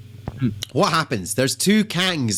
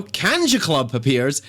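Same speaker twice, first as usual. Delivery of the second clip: usual speaking voice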